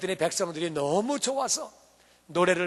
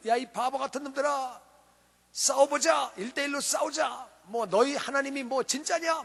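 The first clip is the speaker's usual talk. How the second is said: 골리앗, taunting; 6 semitones higher than usual. Acted. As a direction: taunt them like a cocky bully. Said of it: "골리앗이 나와가지고 이스라엘을 놀리는 거예요". (약 올리며 놀리듯)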